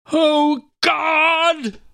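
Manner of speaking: high, strained cry to heaven; a father's voice breaking into a desperate cry; anguished cry. Acted. Father breaks down before sacrifice.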